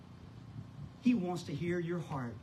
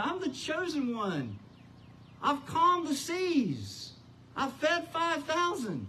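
Jesus challenging; 9 semitones higher than usual. Acted. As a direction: challenge him with full authority. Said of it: "he's challenging him".

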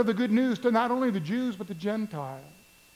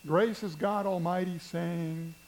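ordinary talk, nothing marked

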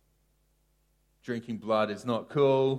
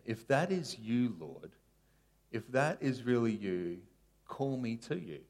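soft pleading prayer; 7 dB quieter than usual. Acted. Peter's plea, softly reenacted.

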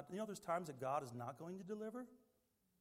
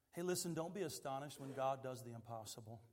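ordinary talk

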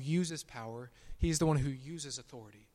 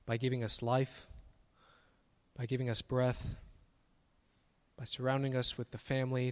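prayer. Acted. Pastor praying to Jesus for the congregation, earnest. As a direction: lead the church in humble thanks.

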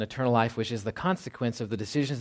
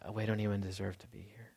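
whispered, hanging the head in shame; a guilty churchgoer whispering that he is unworthy; ashamed whisper; 3 semitones lower, 12 dB quieter than usual. Retold or imitated. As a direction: breathe it out like a guilty confession.

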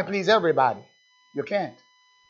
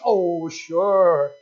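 mock easy agreement; 6 dB louder than usual. Mimics eager worldly churchgoers.

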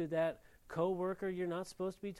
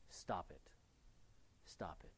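whispered stop; 11 semitones lower, 14 dB quieter than usual. Real, not acted. Whispered repeat after spoken one.

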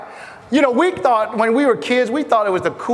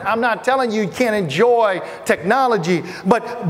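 usual speaking voice

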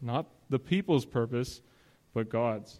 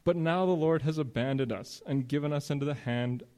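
Gideon's plea; 3 semitones higher than usual. Acted. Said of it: "Gideon responds the way a lot of us would".

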